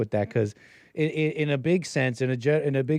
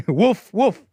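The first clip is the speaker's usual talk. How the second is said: loud, high double woof; imitated dog woof; 7 semitones higher, 8 dB louder than usual. Shown as a demonstration. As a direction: bark out two punchy woofs. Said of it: "you guys like that woof".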